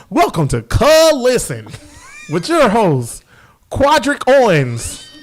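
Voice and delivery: slow, drawn-out announcer intro; mock show announcer. Podcast host jokingly announces the show in a slow, grand announcer voice. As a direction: stretch every name like a grand host.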